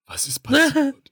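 very high, slow, appalled; shocked teacher. Retold or imitated. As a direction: play the horrified German teacher. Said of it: "jeder meiner Deutschlehrer erstmal so". (entsetzter Deutschlehrer)

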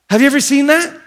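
ordinary talk

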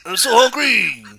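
put-on high, slow whining Cajun accent; starving Cajun voice. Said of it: "are you French or are you Southern?".

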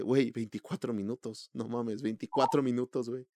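quiet disbelief at the count; stunned, hushed repetition; incredulous. Retold or imitated. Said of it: "y yo así de".

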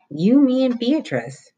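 lightly voiced nervous boy; boy's voice. A narrator's reading. Roy insisting.

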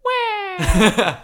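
put-on high baby cry; squeaky baby wah; baby wail. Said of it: "Baby talk".

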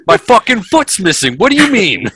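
high, loud, laughing mock outrage; hammed-up father. Father answering his son about his missing foot, hammed-up yell. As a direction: overplay the maimed dad's indignation.

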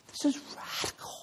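whispered, breathless awe; awed whisper. Retold or imitated. Preacher relives watching the eagle, whispering in awe. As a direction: breathe it out, stunned.

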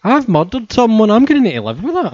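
hammed-up Dorothy dismay; mock Dorothy. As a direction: play the shocked girl realizing her crime. Voicing Dorothy's guilt.

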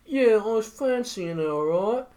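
high, slow mock British reality-show voice; hammed-up British accent. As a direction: parody a Love Island contestant. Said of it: "They're like".

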